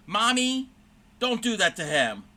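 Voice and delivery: imitating a little girl scolding her mother, high and loud; mock stern little kid, first word high and loud; child scolding mom. Little girl defending the speaker by scolding her mother, high and loud. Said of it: "reprimanding her mother".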